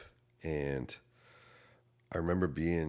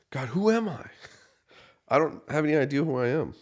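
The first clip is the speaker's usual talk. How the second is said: much higher and louder desperate cry; screaming at God; 9 semitones higher, 6 dB louder than usual. Retold or imitated. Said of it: "I remember screaming".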